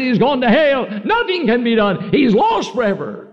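high-pitched retold lament; retold despair. Wife retells giving up on her dying husband, high lament.

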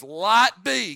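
loud, booming command; voice of God. Acted. Preacher booming God's command "Light be". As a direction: proclaim it like God speaking. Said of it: "God said".

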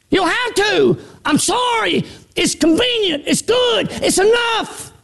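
high, shouted sermon call; preaching at full force. Acted. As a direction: preach it out to the church.

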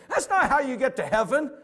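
mock the know-it-all classmate; teen girl voice. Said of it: "She said".

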